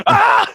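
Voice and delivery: high, loud imitated panicked scream; scared flyer screaming at the fake oxygen mask, imitated. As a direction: let out the friend's startled shriek. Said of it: "He's like".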